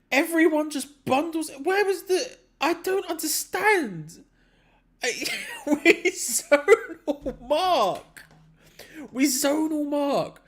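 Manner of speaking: can't believe the marking; exasperated. Real, not acted. Exasperated at the corner goal.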